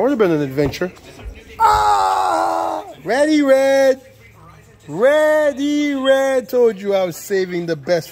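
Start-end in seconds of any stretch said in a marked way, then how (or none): 1.6-2.8 sudden low startled yell at the car; surprised shout. Real, not acted. Gasps at the red interior. 3.0-6.5 high, loud showman call; excited announcement. Real, not acted. Announces red interior excitedly.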